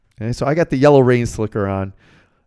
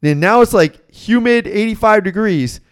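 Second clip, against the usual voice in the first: higher, louder, heated complaint; fired-up rant; 6 semitones higher, 3 dB louder than usual. Retold or imitated. Young usher ranting at the boss about the slicker, fired up. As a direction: rattle it off all fired up at the boss. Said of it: "I get all fired up".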